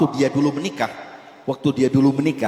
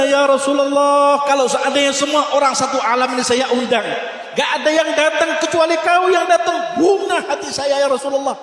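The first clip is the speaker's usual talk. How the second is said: tearful cry; 11 semitones higher than usual. Acted. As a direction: cry out to him through tears. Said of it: "Nangis sahabat ini".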